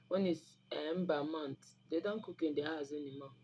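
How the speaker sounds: ordinary talk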